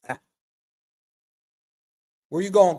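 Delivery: usual speaking voice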